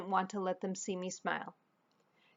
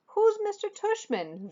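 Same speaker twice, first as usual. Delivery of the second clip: groggy; 13 semitones higher, 3 dB louder than usual. Acted. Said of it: "Via said groggily".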